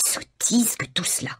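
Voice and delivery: breathy whispered dismissal; mother scoffs at the whole business in a whisper. A narrator's reading. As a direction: hiss it under your breath.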